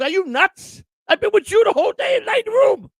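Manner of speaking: very high, shouted mock outrage; indignant retort, imitated. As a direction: imitate an exasperated girlfriend shouting back in a high voice. Acts out girlfriend's indignant retort in quarrel.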